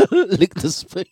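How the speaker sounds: mock sly line that collapses into giggles; breaking up laughing. Host attempts the ex's spoon line, laughing through it. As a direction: try the voice but crack up mid-line. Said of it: "I can't even do it straight".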